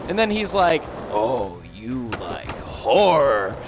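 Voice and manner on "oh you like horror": low, very slow spooky voice; ominous old man. Retold or imitated. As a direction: draw it out deep and menacing, hammed-up. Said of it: "he starts putting on this ominous voice".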